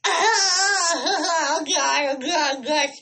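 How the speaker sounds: high, loud giddy fangirl squeal; gushing schoolgirl. Retold or imitated. Fangirl hears the singer told she loves him, gushing. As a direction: re-enact swooning like a starstruck schoolgirl. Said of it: "Gushing like a schoolgirl".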